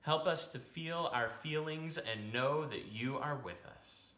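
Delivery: ordinary talk, nothing marked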